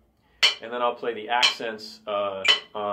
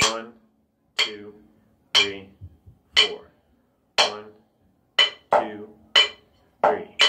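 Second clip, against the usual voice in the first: evenly spaced count in four, then slower in three; counting the rhythms; slower than usual. Shown as a demonstration. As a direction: count in time with the sticks.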